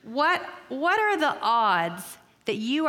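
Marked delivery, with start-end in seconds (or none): none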